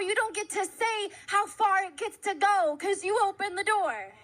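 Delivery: preaching at full force. Real, not acted.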